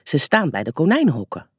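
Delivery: usual speaking voice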